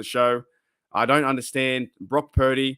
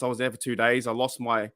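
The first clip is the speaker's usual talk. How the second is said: ordinary talk, nothing marked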